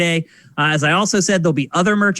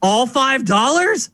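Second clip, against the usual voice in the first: incredulous grandfather; 7 semitones higher, slower than usual. Retold or imitated. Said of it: "he goes".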